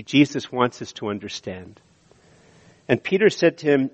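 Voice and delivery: normal talking voice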